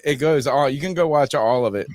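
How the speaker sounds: normal talking voice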